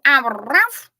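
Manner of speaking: loud, high two-part cat call; a loud, high mock cat yowl; two-syllable meow. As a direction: bark it like a cat splitting its meow. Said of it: "Or does she go".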